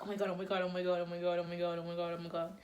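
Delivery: rapid monotone panicked chant; inner panic. Retold or imitated. Teen retelling silent panic as her crush walks with her, panicked chant. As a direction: run it together in an under-the-breath mental loop. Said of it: "shaking on the inside of my head".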